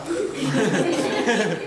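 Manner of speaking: short amused chuckle; real laugh. Real, not acted. Preacher laughing at his Superman and Terminator comparison. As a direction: laugh at your own joke.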